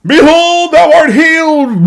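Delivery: loud, high, slow mock prophet booming "Behold, thou art healed"; grand prophet proclamation. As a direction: proclaim it like a showy prophet in the doorway. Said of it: "stand in the door and go".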